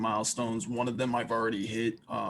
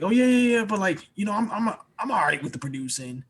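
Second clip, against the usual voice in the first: imitating his old self, higher and brushing it off; mock-casual shrug, playing down his own skills; downplaying himself; 6 semitones higher than usual. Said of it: "I always try to downplay my existence".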